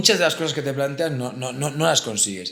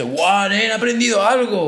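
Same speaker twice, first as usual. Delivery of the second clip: excited cry; 7 semitones higher, 3 dB louder than usual. Retold or imitated.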